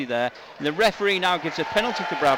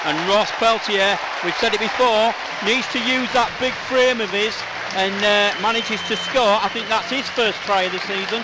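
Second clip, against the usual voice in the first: louder, higher call as the try goes in; excited try call; 6 semitones higher, 7 dB louder than usual. Real, not acted. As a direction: celebrate the score on air, voice lifted.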